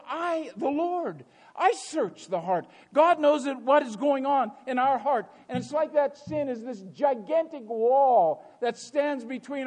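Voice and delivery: high, insistent pulpit delivery; impassioned preaching. Acted. Preacher warning congregation about the wall of sin, impassioned. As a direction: drive the diagnosis home from the pulpit.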